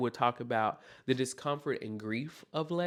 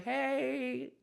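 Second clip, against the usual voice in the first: high, soft, drawn-out voice; softly sing-out a slow, high greeting; grief personified; 7 semitones higher, 5 dB quieter, much slower than usual. Acted. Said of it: "It's gonna be like".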